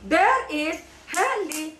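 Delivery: "There is heli" slowly, each beat apart; syllable by syllable. Shown as a demonstration. Teacher has child try again, syllable by syllable. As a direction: model "There is heli" in slow separate syllables. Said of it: "There is, there is again".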